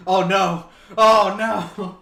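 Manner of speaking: loud, high delighted dismay; shouted oh no. Real, not acted. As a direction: yell it out in mock horror. Reacting to baby cosplay idea.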